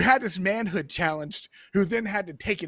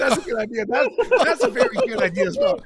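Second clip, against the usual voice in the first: laughing approval; 7 dB louder than usual. Real, not acted. Laughing approval of friend's idea.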